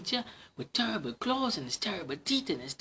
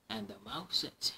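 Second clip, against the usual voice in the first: ordinary delivery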